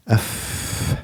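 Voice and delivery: long hissed "fff", pure air; voiceless flow of breath. Shown as a demonstration. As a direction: let the breath stream out on an f, without voice. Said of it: "This is flow of breath".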